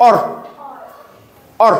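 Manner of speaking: অর with a rounded 'o' before r; isolated sound demo. Shown as a demonstration. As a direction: model অর alone, pausing between repeats. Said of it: "ও আর দিয়ে উচ্চারণ হবে অর".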